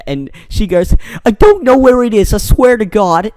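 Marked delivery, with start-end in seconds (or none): 1.2-3.3 high, loud mock-frightened Boston plea; funny Boston accent. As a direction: plead shrilly in a hammed-up Boston accent. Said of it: "everyone in there has, like, a Boston accent".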